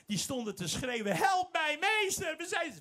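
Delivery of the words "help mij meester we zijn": high, loud desperate plea; crying out for help. Acted. Lepers cry to Jesus for help, loud pleading. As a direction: call out to the master in desperation.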